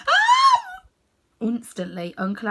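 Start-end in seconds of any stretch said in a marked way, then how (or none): 0.1-0.8 sudden high shriek of terror; scream. Acted. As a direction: let out a piercing scream. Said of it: "he let out a scream".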